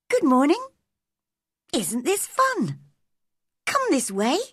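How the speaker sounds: high, playful little bird voice; chirpy robin. A narrator's reading. Robin invites Mary along the wall, high and chirpy. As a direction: sing out high like a cheerful bird.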